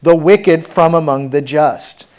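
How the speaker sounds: loud, slow, weighty Bible reading; preacher reading out a stern warning; forceful scripture reading. Acted. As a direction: proclaim the verse slowly and forcefully.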